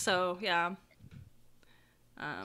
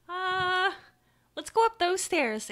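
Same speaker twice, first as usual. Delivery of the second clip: put-on high-pitched kid voice; high young boy; little boy suggesting; 7 semitones higher, 4 dB louder than usual. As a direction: pipe up with a child's suggestion. GM answers as the boy.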